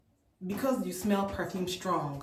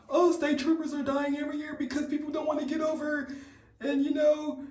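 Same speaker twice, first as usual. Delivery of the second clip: a hint of a trooper's droning lecture, mildly higher; droning officer lecture; 8 semitones higher than usual. Vlogger mimicking the trooper's safety speech, lightly droning. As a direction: lightly imitate a monotone cop lecture. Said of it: "he talked in that exact same tone".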